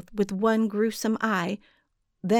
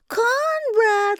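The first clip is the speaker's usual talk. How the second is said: much higher, loud sing-song call; sweet calling giantess; 5 dB louder than usual. A narrator's reading. Giantess calls Conrad again, higher and louder. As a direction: stretch the name out, calling louder. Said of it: "began to call in that strange, sweet voice".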